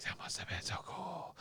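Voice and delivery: whispered audience reaction, very soft; breathy, hushed mock admiration; hushed awe. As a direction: whisper it like an impressed listener. Imagined listeners reacting to the stories.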